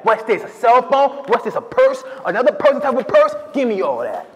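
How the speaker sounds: loud, high-pitched hammed-up hold-up with a whoop; hammed-up robber act. Lineup suspect taking another try at the dialogue, over-the-top loud robber. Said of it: "Let me give it another one".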